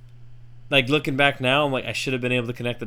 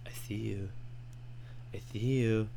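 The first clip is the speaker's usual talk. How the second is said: spooky hushed tease; 9 dB quieter than usual. Pretend. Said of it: "should have been like".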